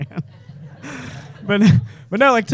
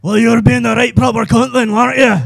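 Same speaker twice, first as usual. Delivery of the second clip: put-on louder Scottish accent, a touch higher; Scottish Batman; 5 semitones higher, 7 dB louder than usual. Scottish Batman telling off a blocker, loud Scottish accent. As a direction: scold them in a broad Scottish accent. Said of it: "but he'd be Scottish".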